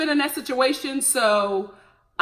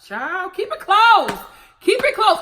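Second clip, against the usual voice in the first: mock warning; 9 semitones higher, 8 dB louder than usual. Real, not acted. Warning aside to Brittany.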